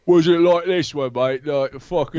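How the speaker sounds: really grungy British voice; grungy British accent. Shown as a demonstration. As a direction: put on a grungy British lad. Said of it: "The really grungy one".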